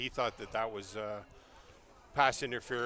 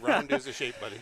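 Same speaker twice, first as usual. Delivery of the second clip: laughing; 9 semitones higher, 7 dB louder than usual. Real, not acted. Teasing partner, laughing.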